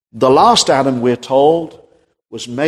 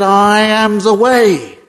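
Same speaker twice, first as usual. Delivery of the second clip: preaching Jesus' words; 9 semitones higher, 3 dB louder than usual. Acted. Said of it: "But the Lord Jesus said".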